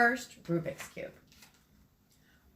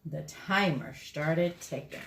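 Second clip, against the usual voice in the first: ordinary delivery, nothing marked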